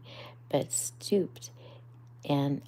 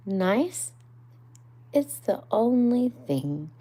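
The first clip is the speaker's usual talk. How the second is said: higher, louder earnest declaration; solemn Rat; 6 semitones higher, 8 dB louder than usual. Acted. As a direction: declare it like a truth of life. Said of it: "said the water rat solemnly".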